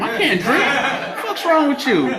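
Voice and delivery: high, incredulous mock outrage; exasperated protest. Man in a program refusing woman's drink, exasperated. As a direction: protest with pitch leaping up. Acted argument about drinking.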